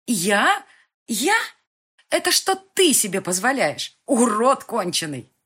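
high, loud screaming retort; girl screaming back at her abuser; furious shout. Acted. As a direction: hurl it back at him, stress on ты.

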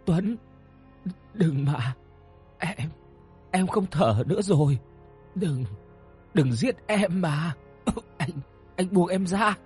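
high, strangled begging with coughs; choked pleading. A narrator's reading. Woman being strangled begs Tuấn to let go, choked pleading. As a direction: plead while struggling for breath. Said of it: "vừa khó thở".

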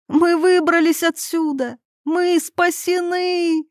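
high-pitched, drawn-out elation; jubilant cry. A narrator's reading. Irina throwing herself at Yuri, jubilant cry. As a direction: cheer with overflowing relief. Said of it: "закричала Ирина".

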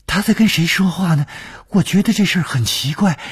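high-pitched anxious report; alarmed, urgent. A narrator's reading. Maid telling her mistress of the empty room, high alarmed urgency. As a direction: voice climbing high with unease.

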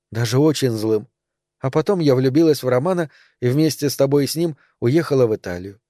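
ordinary delivery, nothing marked

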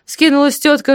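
usual speaking voice

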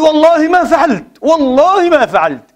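accused man protesting his innocence loudly; swearing denial. Retold or imitated. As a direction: swear it urgently twice. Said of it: "قال له".

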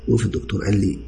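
usual speaking voice

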